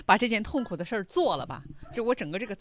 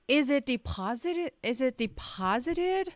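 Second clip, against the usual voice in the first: hollow vibrating voice; 7 semitones higher than usual. Shown as a demonstration. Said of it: "Hollow是非常空洞的".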